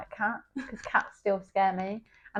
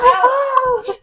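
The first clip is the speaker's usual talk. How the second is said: loud high shriek of fright; frightened shriek; 10 dB louder than usual. Retold or imitated. On the motorway, shrieking at the open car door. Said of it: "and I was like".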